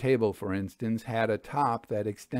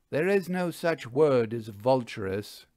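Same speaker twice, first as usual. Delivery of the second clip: ordinary delivery, nothing marked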